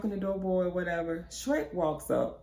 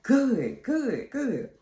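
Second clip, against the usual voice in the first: high, falling "good" each time; emphatic approval; 7 semitones higher, much slower than usual. Retold or imitated. Reply to friend keeping the visit secret, emphatic. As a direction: punch each "good" separately, pitched up.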